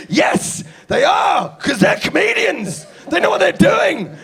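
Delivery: high, loud furious shout; angry heckler yelling. Retold or imitated. Angry heckler shouting that the others are real comedians. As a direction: yell it in his face. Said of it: "he goes".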